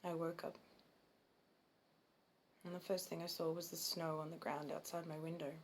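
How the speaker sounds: put-on subdued childhood recollection with long pauses; understated, soft dramatic monologue; Ken in monologue. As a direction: recall it haltingly, leaving silence between phrases. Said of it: "the part I'm playing is Ken".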